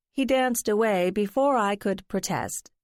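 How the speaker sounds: usual speaking voice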